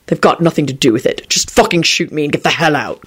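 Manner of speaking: mildly irritated, restrained voice; irritable reply. A narrator's reading. Quentin to the gunmen, mildly irritable. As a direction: answer with understated irritation. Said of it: "Quentin replied irritably".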